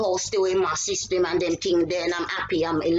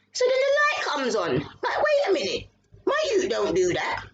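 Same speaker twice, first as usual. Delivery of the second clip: sudden high alarmed telling; reliving the shock, voice jumping high; 6 semitones higher than usual. Real, not acted. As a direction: pitch shoots up in alarm. Alarmed retelling of intruder.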